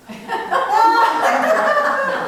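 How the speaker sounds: hearty high laugh; amused laugh after teasing the class. Real, not acted. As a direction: burst out laughing at your own joke. Laughs at own joke.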